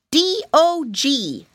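high, loud, slow spelling; little pig voice. Acted. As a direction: spell it out proudly like a piglet.

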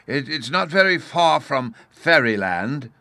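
high, loud, slow hopeful suggestion; hesitant professor. A narrator's reading. Professor offers a landmark to the puzzled officer, hesitant and hopeful. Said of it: "the professor suggested".

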